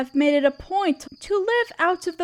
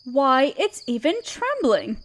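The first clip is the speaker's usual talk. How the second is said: ordinary talk, nothing marked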